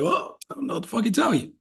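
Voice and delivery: fast, high, offhand mock shrug; shrugging reply. Tag team brushing off their angry teammate, fast high shrug. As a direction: toss it off quickly, a high dismissive shrug. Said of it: "that tag team's like".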